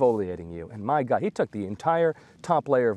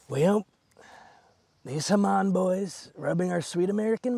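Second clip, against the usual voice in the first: Trump impression; 7 semitones higher than usual. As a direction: do a bad Trump, lilting and lingering. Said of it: "I cannot do a Trump impression".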